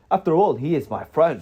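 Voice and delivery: put-on emphatic old-tale wizard dialogue; wizard character voice. A narrator's reading. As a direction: speak up as the wizard defending a friend.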